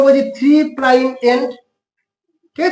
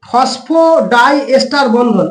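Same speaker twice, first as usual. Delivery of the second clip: slow term; much slower than usual. Shown as a demonstration. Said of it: "এইটাকে আমরা বলি".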